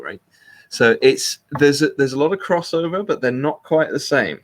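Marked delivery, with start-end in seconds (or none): none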